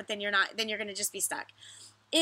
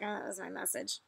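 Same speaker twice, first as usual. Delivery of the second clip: much softer, embarrassed "that was my message"; sheepish "oh"; 3 semitones lower, 10 dB quieter than usual. Pretend. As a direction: deflate it, a little caught out. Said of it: "Or you can be like".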